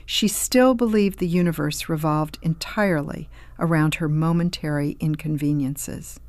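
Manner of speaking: usual speaking voice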